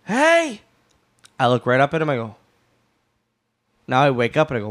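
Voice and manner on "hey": yelling hey. Retold or imitated. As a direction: call out sharply to someone asleep. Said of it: "He goes".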